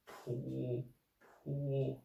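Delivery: "pu" stretched on a dipping tone; third tone. Shown as a demonstration. Teacher practises pinyin with learners, dipping third tone. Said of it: "the third tone".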